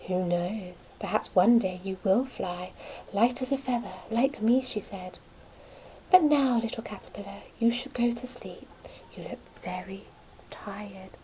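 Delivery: soft, fading lullaby voice; soothing. Acted. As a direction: soothe him gently to sleep. Butterfly soothes caterpillar to sleep.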